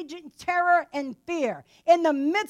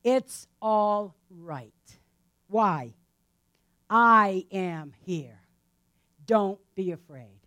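very slow, lower, calming words; Jesus speaks slowly and lower to calm the disciples; calm reassurance; 7 semitones lower, much slower than usual. Acted. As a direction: soothe frightened men in a storm. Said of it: "Jesus comes to them at once and says".

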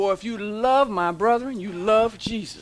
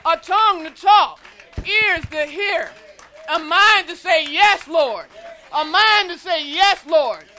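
loud, rising preacher's cadence; preaching at full force; 4 semitones higher, 5 dB louder than usual. Real, not acted. Preacher urging the congregation to praise. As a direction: drive each praise line home.

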